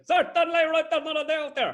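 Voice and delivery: high, loud exaggerated commentator voice; high, loud overblown pundit voice; blown-up impression. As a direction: blow the voice right up, hammed. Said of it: "when I'm doing it".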